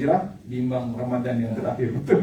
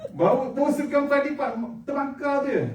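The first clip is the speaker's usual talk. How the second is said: high, drawn-out comic exclamation; comic excitement; 11 semitones higher, slower than usual. Retold or imitated. Preacher joking to audience about burnt rice husks, comic excitement. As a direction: stretch it out high, relishing the smell.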